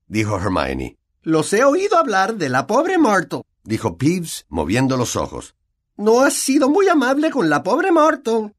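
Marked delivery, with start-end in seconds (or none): 1.2-3.4 high sly voice, teasing; mischievous poltergeist. Acted. Peeves teasing the three kids, high and sly. 6.0-8.5 high sly voice, teasing; mischievous poltergeist. Acted. Peeves teasing the three kids, high and sly.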